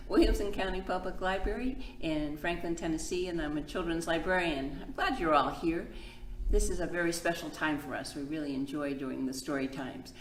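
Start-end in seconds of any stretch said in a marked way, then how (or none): none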